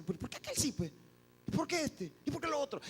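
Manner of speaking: high-pitched, put-upon questioning; envious complaint. Acted. Believer comparing himself with others, enviously complaining. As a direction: whine each question like someone keeping score.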